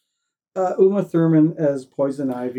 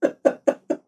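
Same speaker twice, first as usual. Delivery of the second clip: breathless laugh. Real, not acted. Laughing at own impression.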